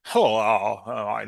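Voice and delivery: put-on phone voice. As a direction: drop the voice low like answering a call. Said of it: "don't they change their voice".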